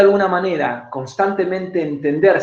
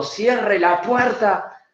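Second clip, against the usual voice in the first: high, slow beastly order; animal growl; 7 semitones higher, much slower than usual. Shown as a demonstration. Coach shows the angry body version, high and slow. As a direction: let the animal out and roar it slowly. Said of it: "le va a salir un animal de adentro".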